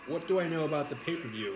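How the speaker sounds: ordinary delivery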